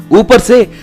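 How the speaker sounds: ordinary delivery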